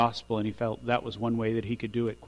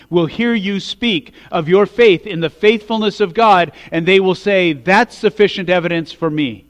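rising, much louder preaching that builds to a peak; preaching at full force; 5 semitones higher, 10 dB louder than usual. Acted. Pastor urging his congregation, building to a loud preaching peak. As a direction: preach it out loud and climbing toward the climax.

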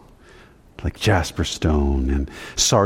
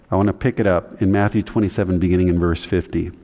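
normal talking voice

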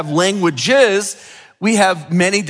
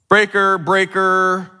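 CB radio call; 2 semitones higher, slower than usual. Shown as a demonstration. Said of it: "say this".

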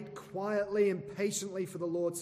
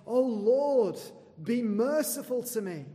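a loud, higher plea, calling on the Lord; crying out to God; 7 semitones higher, 8 dB louder than usual. Retold or imitated. Preacher voicing David crying out for mercy, loud pleading. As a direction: cry it up to heaven for mercy. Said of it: "He was saying".

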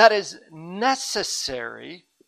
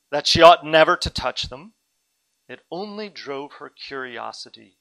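usual speaking voice

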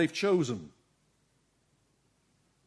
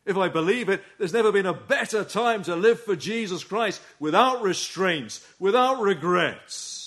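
raised, rallying sermon voice; impassioned preaching; 4 semitones higher, 6 dB louder than usual. Real, not acted. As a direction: urge the congregation on with conviction. Urgent preaching at full force.

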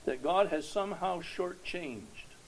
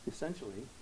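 normal talking voice

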